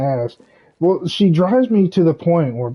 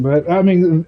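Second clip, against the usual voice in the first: usual speaking voice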